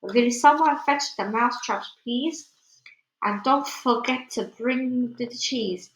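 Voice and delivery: plain reading voice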